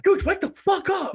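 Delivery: loud, very high mock scream; yelling at a teammate. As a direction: imitate an angry gamer's outburst. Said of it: "yelling at me".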